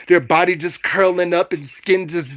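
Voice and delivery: normal talking voice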